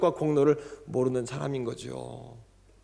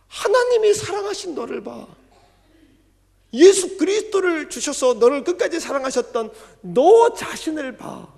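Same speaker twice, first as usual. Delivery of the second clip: tender blessing; 13 semitones higher, 10 dB louder than usual. Shown as a demonstration. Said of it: "이런 뜻입니다".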